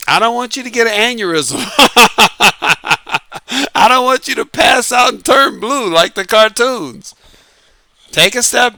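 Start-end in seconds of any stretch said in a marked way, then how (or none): 0.1-7.1 laughing through the line; cracking up while teasing; amused, half laughing. Real, not acted. Laughs, mocking angry viewers.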